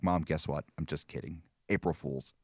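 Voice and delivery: sheepish quiet reveal of the prank; sheepish confession. Retold or imitated. Son admitting prank to mom, low and sheepish. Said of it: "call her back".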